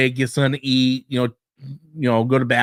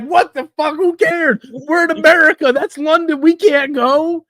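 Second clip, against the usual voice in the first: exasperated; 11 semitones higher than usual. Retold or imitated. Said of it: "I'm like".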